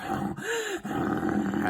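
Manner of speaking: the dog drifts off with a high soft growl; sleepy dog growl. Retold or imitated. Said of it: "the dog's like".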